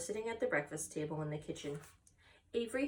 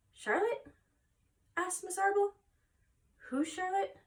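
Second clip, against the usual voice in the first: high, puzzled mother asking about Charlotte; a mother caught off guard, voice lifted high; mother's surprised question; 12 semitones higher than usual. Acted.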